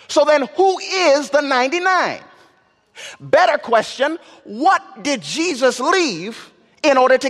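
rising, ringing pulpit cadence; preaching at full force. Acted. Preacher stirring his congregation, full force. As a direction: preach it up to the rafters.

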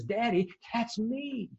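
put-on high, slow little boy's voice; small boy realising it's him; a son to his father, in a high, slow child's voice.

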